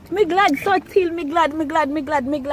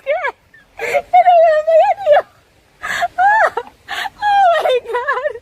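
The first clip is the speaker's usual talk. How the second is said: high, tearful wail; overcome, crying loudly; 14 semitones higher, 4 dB louder than usual. Real, not acted. Overwhelmed on hearing the news.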